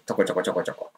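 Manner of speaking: low, soft little patter; imitated small voice. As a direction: mimic quiet, constant instructions. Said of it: "大きい声じゃないんだけど". (小さく低い声でちょこちょこと真似て)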